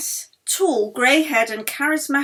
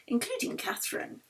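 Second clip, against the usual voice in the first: ordinary delivery, nothing marked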